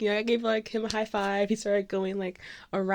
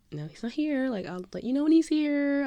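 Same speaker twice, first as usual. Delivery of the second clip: higher-pitched acted impatience; exasperated retort; 9 semitones higher than usual. Fan answering her friend's repeated question, exasperated. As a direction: brush off a pestering friend.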